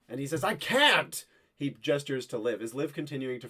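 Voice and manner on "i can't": high, loud protest; soldier's outburst. Acted. Soldier protesting to the woman at the door, loud. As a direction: cry it out in frustration.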